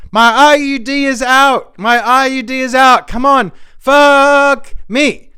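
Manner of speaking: high, loud, breathless mock-sultry moaning; Olivia Culpo impression. Comic telling his audience how Olivia sounds, high loud mock moaning. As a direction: ham up a model begging in bed. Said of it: "Here's Olivia, the model".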